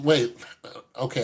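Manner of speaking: ordinary talk, nothing marked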